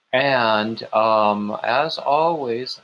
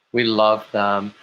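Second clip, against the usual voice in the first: usual speaking voice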